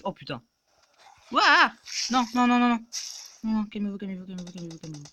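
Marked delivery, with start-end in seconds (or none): 1.3-5.0 panic fading to hush. Real, not acted. Zombies close in.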